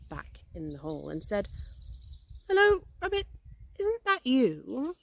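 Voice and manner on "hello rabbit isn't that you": high call, slowing to a questioning end; hopeful calling. A narrator's reading. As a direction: call in to a friend you suspect is there. Pooh calls hopefully into the hole.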